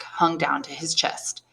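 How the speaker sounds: ordinary delivery, nothing marked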